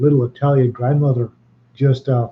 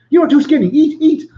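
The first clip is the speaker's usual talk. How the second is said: higher, louder mock-insistent nonna; insistent Italian grandmother; 13 semitones higher, 5 dB louder than usual. Italian grandmother fussing over a skinny guest, higher and louder mock insistence. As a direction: push the food on him like a fussing Italian grandmother.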